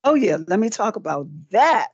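ordinary talk, nothing marked

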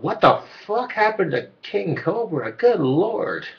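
high, slow incredulous voice; mocking viewer. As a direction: gasp it out in disbelief. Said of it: "people are like".

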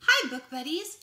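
luide vrolijke begroeting; cheerful loud greeting. Acted. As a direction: begroet de kinderen luid en opgewekt. Enthusiastic greeting to child viewers.

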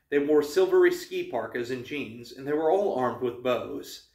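ordinary delivery, nothing marked

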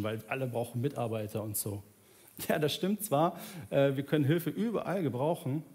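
ordinary talk

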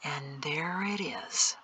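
slow, very hushed and low; dark muttering. A narrator's reading. Peto remarks on what was said, darkly. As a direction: say it darkly, almost whispering. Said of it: "Peto said darkly".

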